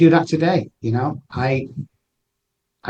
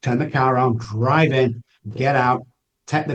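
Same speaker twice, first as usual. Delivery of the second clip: ordinary talk, nothing marked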